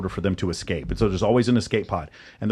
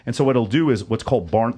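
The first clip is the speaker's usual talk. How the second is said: ordinary talk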